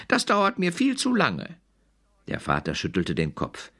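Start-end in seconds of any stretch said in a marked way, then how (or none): 0.1-1.6 very high, complaining cub voice; whiny cub. A narrator's reading. The cub complaining to his parents, very high and whiny. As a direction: whine it like an impatient little bear. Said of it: "brüllte der kleine Brüllbär".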